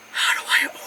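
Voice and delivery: breathy whisper of sudden panic; hushed, panicked tourist whisper. Retold or imitated. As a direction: whisper it like you're stuck at the counter. Panicking at the counter.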